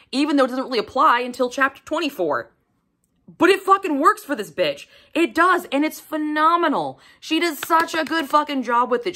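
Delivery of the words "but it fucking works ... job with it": higher pitched excited praise; excited gushing. Real, not acted. Reviewer raving to viewers about the author, lifted pitch. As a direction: rave about her, lifted.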